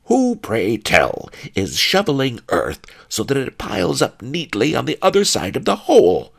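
loud, high-pitched angry snarl. A narrator's reading. Pawnbroker snarling at his colleague over the shoveling, loud snarl. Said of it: "snarled Pawnbroker Fang".

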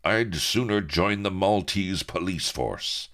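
high, indignant hero voice; scornful refusal. A narrator's reading. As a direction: turn the offer down with high-pitched scorn. Hero scorns pirates' offer.

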